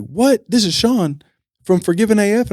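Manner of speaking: high, excited disbelief; imitating an excited fan; surprised. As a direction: sound amazed it's really him. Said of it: "And he was like".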